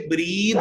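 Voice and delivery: "breathe" with a long ee vowel. Shown as a demonstration. Teacher showing students the correct pronunciation, long-ee breathe. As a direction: say breathe with a drawn ee. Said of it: "इसकी correct pronunciation".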